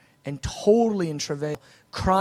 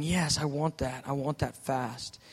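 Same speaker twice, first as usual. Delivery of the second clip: slow, soft, lower eager agreement; quiet earnest yes; 3 semitones lower, 8 dB quieter, slower than usual. Retold or imitated. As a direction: say it slow and hushed, from the heart. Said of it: "and I'm saying".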